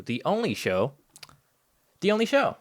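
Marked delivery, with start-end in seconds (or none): 2.0-2.6 high, loud hammed-up repeat; playful high-pitched proclamation; mock grandiose echo. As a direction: sell it like a tagline. Echoing catchphrase.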